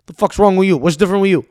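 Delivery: a lightly voiced mob boss, gruff and loud; terrible Tony Soprano. Tony comes home, snaps at his son, mock gruff mob dad. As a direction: bark it like a rough New Jersey dad, half-hammed. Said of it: "It was a terrible Tony Soprano".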